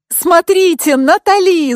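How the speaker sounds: high, loud cry of excitement; excited yell. A narrator's reading. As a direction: yell it out to get everyone watching. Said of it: "завопила Яна".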